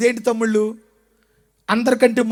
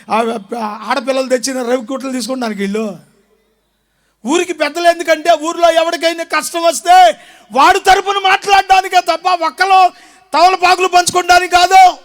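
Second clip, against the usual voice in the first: normal talking voice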